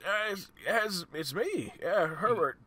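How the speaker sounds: put-on high, slow, wavering old-timer; old man voice. [name] greets Herbert after ten years, old man voice. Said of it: "I gotta do an old man voice".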